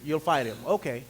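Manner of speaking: a hint of a skeptical low king; the king doubting David, low and skeptical; doubtful king. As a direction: humor the boy in disbelief. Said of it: "Imagine the response of the king".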